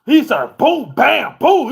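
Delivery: loud high boom boom; loud mock crash noise; imitated banging. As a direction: bang it out like dogs hitting the cage. Imitating dog hitting cage.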